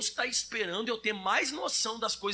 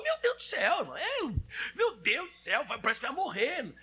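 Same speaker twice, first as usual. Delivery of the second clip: panicky wail; 3 semitones higher than usual. Retold or imitated. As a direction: fret like it's the end. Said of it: "Tem gente que passa uma luta". (lamento desesperado e agudo, de brincadeira)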